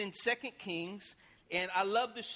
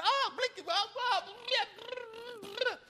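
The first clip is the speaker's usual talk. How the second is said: lightly hammed-up panicked cursing, higher; mock cursing passengers; 13 semitones higher, 4 dB louder than usual. Passengers cursing mid-air, mock panicked babble. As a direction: imitate frightened passengers swearing. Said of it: "They're going".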